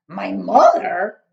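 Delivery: loud, slow booming cry; giant's loud cry. Acted. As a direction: cry it out in disbelief. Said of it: "cried the B F G".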